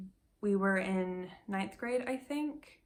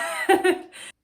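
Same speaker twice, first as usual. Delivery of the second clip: burst of laughter; 12 semitones higher, 13 dB louder than usual. Real, not acted. Laughs at own remark.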